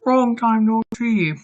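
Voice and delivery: quick mock of Jared's line; a hint of Jared's voice; Jared impression. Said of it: "I gotta do my best Jared impression".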